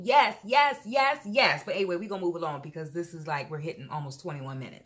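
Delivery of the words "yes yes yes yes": loud, high hype yeses; excited yes. Real, not acted. Hyping her viewers about the haul, loud excited cheers. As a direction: cheer yourself on, louder and higher.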